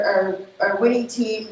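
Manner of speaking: usual speaking voice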